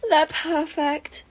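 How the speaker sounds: slow, high, drawn-out swoon; dreamy sigh. A narrator's reading. May swooning over her favourite couple, slow. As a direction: savour every word.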